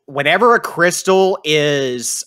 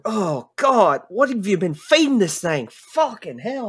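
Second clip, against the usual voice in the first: high, strained choked cursing; disgusted NPC gagging; 6 semitones higher than usual. Acted. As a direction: recoil from the awful smell. GM voicing NPC smelling fart.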